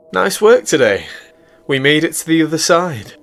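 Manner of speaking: playful woman. A narrator's reading. Celine greeting the captain at his bunk, high and teasing. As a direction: tease him up high, like his partner.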